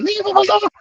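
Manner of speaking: very high-pitched imitated scream; retold screaming. Women outside scream at police kicking him, high mimicked scream.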